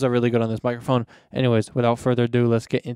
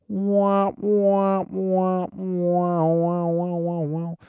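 high-pitched mouthed trombone, sliding down at the end; sad trombone womp-womp; 6 semitones higher, much slower than usual. Shown as a demonstration. Podcaster showing how the joke is supposed to sound, trombone womps. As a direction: sing out drawn womps like a muted horn. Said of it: "it's supposed to be like".

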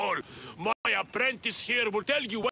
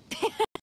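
a short high laugh. Acted. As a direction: let out a quick high laugh. Blacksmith laughs.

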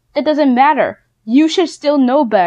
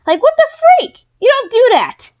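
much higher, louder outraged outburst; outraged yell; 12 semitones higher, 5 dB louder, faster than usual. Real, not acted. A ranting vlogger erupts at a bully begging for Robux, outraged and loud. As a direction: blurt out disbelief at the top of your voice.

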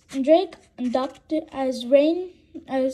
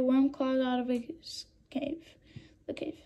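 whispered; 9 dB quieter than usual. Real, not acted. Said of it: "narration drops to a whisper".

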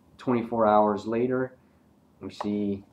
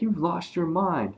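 mock scolding dad; 9 semitones higher than usual. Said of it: "He's like".

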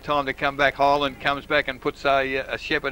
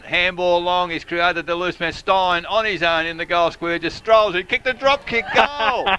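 rising in pitch and volume; excited live football commentary; building goal call; 4 semitones higher, 4 dB louder than usual. Real, not acted. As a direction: build to a loud, high climax on goal.

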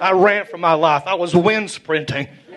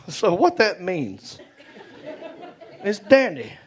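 ordinary talk, nothing marked

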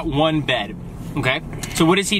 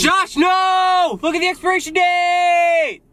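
shouting warning; 9 semitones higher, slower than usual. Real, not acted. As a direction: yell it to stop him. Warning friend about expired milk.

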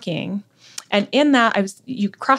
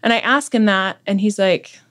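ordinary talk

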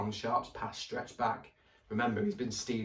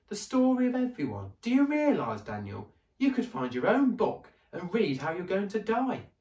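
put-on much higher, lively little girl; girl's voice; 10 semitones higher, 6 dB louder than usual. A narrator's reading. Ellie wondering at the library, much higher girl voice. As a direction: marvel aloud like an excited child.

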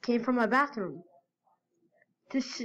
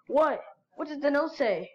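ordinary delivery, nothing marked

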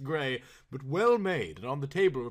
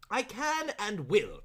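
proclaiming; 7 semitones higher, slower than usual. Acted. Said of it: "proclaimed Mister Saltena".

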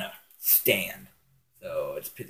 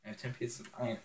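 ordinary talk

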